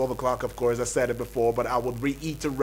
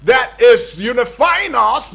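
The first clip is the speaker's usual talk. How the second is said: loud, slow shout on "unifying"; rising loud call; emphatic shout; 8 semitones higher, 10 dB louder, slower than usual. Real, not acted. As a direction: build up to the big reveal. Host builds up to language.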